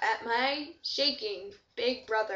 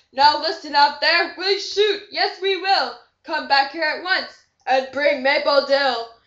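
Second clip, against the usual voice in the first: loud barked threat through loudspeakers; roaring generals; 9 dB louder than usual. Acted. Generals roar up at space through loudspeaker dishes. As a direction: bellow it like an angry general. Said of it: "generals roared till red in the face".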